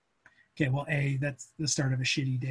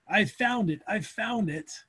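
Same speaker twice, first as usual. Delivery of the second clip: retold yelling; 5 semitones higher, slower than usual. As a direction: imitate someone yelling through town, held back.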